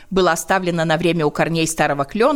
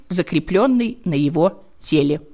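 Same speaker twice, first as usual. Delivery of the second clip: usual speaking voice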